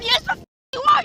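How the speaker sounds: loud, outraged shout; furious yell. Real, not acted. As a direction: hurl it back in rage. Woman yelling back at officer.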